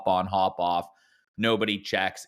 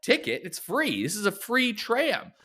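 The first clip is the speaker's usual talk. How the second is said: mock surprise; 12 semitones higher than usual. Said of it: "And I'm like".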